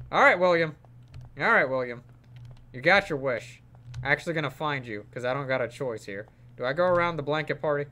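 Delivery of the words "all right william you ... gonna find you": normal talking voice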